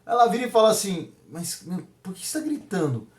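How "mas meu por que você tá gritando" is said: imitating a quiet, puzzled wife; much softer, gently asking why; soft-spoken reproach.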